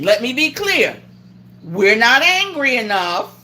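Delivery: usual speaking voice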